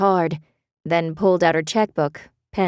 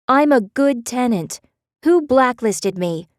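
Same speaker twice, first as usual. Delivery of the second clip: indignant; 7 semitones higher, 3 dB louder than usual. A narrator's reading. Beth indignant.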